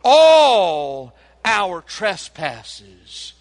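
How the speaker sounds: all drawn out very slow and loud; stress on all. Shown as a demonstration. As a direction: lean long and heavy on all in all our trespasses. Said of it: "I love that little word, all".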